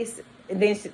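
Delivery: usual speaking voice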